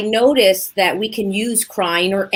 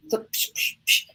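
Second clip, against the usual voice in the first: soft hissed "psh" bursts; mock tantrum noises; 7 dB quieter than usual. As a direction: imitate a toddler's explosion with whispery puffs. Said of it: "like a little two-year-old in a room throwing a tantrum".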